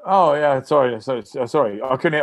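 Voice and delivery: high, stumbling mock apology; flustered apology. Player realising it really is the coach, acted flustered and apologetic.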